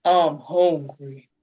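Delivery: very low, slow soldier growl; deep soldier voice. Pretend. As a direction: drop it low like a drill soldier. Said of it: "can you do that in, like, a deeper voice?".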